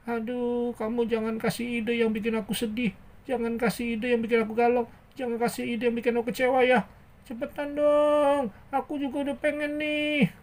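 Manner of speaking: mock-miserable whine; gloomy pleading character. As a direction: beg in a pouty puppet voice.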